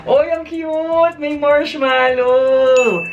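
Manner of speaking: high, slow, drawn-out gush of delight; real excitement, high and stretched; delighted squeal. Real, not acted. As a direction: squeal over the cute find. Unboxing gift, squeals over marshmallow.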